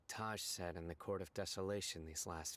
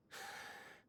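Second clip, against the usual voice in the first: soft sigh; 7 dB quieter than usual. Acted. Weary over criminals' cruelty.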